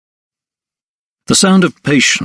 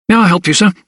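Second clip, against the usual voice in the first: put-on high-pitched, eager young clerk; helpful clerk; 8 semitones higher, 3 dB louder, faster than usual. A narrator's reading. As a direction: offer help in a high, polite voice. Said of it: "a more helpful disposition".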